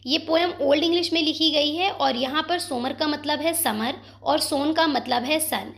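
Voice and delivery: ordinary delivery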